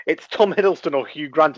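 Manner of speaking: usual speaking voice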